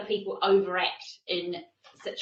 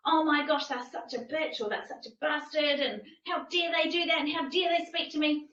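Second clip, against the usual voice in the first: mock outrage; 6 semitones higher than usual. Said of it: "I'm not going".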